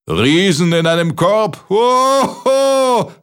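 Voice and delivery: high giant bellow with big ho-hos; roaring with laughter. A narrator's reading. Giant mocking the basket idea, roaring to the sky. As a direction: howl it skyward in booming mock laughter. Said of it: "gröhlte der Riese in den Himmel".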